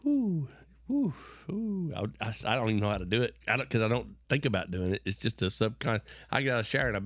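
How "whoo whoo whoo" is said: high breathy whoo of exhaustion; a weary man's high "whoo" at day's end; tired man's whoo. Retold or imitated. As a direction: let out the worn-out whoo sound. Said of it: "That's your sound".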